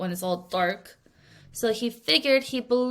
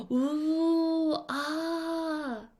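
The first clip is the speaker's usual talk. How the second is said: higher, soft amazed ooh-ah of a crowd; impressed ooh and ah; 7 semitones higher, 4 dB quieter than usual. Pretend. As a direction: gasp in wonder at the trick. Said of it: "everyone in the audience goes".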